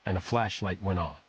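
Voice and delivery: plain reading voice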